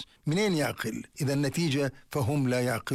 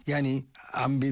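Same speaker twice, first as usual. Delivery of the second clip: ordinary delivery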